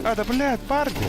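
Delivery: high, loud warning yell; grenade warning yell. Real, not acted. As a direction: shout the warning to the team. Grenade callout mid-fight.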